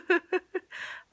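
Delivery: high embarrassed laugh through tears; teary high laugh. Real, not acted. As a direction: laugh it off while tearing up. Laughing at her own tears.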